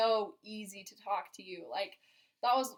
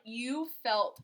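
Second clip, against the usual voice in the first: ordinary talk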